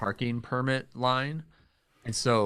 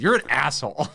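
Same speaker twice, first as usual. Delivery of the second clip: a louder mock outburst with a laugh; laughing quote; 10 dB louder than usual. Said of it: "threw his hands in the air and he said".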